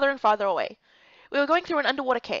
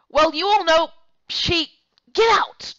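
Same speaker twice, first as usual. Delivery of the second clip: louder and much higher, exasperated shout; shouting order; 6 semitones higher, 8 dB louder, slower than usual. Acted. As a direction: drive them out of the room. Nurse shouts the men out.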